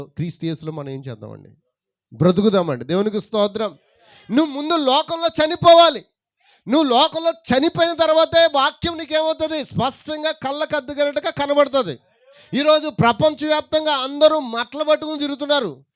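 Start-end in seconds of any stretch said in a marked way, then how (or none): 4.3-15.8 higher and louder, driving each line home; fervent preaching. Acted. Preacher pressing the congregation, high and loud. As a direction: preach it hard to the whole church.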